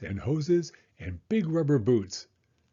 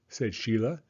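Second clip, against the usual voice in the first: ordinary delivery, nothing marked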